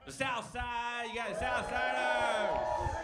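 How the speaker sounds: drawn-out shout to the crowd; crowd callout shout. Real, not acted. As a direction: call the neighborhood out loud. Calling out to crowd.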